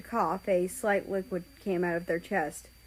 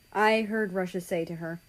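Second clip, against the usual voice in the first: ordinary delivery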